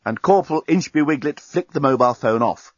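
ordinary delivery

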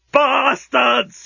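high-pitched scream of rage; cracked, outraged shriek; furious scream. A narrator's reading. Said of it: "he screamed at the flapping silk sheets".

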